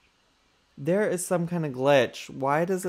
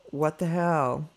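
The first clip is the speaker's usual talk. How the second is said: normal talking voice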